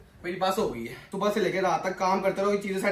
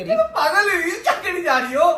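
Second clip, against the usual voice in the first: high, laughing, half-crying protest; laughing in disbelief; 10 semitones higher, 4 dB louder than usual. Real, not acted. Husband laughs in disbelief as his wife grabs the laptop. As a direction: laugh through the words, pitch way up.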